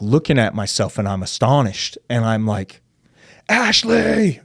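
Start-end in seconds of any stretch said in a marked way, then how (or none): 3.5-4.4 high, drawn-out call to another room; retold yell, pitched way up; yelling for wife. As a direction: holler her name, astonished. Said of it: "yelling my wife's name".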